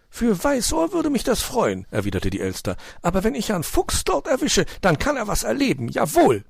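high magpie voice, mildly threatening; stern magpie. Acted. Frau Elster droht Herrn Fuchs, hohe Stimme. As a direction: promise the fox a scolding.

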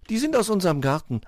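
put-on high-pitched girl's answer; reply high and guileless; innocent Schneewittchen. A narrator's reading.

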